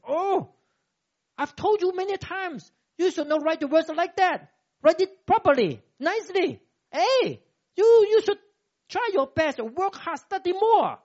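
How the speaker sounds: put-on high, loud nagging parent scolding a child. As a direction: play the exasperated parent telling off the kid. Preacher voices scolding parent.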